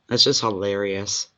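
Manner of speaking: ordinary talk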